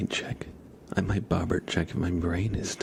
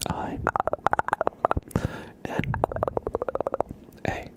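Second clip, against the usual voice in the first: ASMR whisper. Real, not acted. ASMR whispered queue and name traces.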